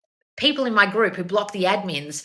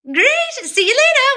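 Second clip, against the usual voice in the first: mock cheerful goodbye; 8 dB louder, slower than usual. Acting out overly polite reply.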